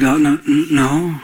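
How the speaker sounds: mock flustered stammer; imitating someone caught off guard, stumbling over a simple no; halting, thrown-off reply. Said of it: "she didn't know how to handle it".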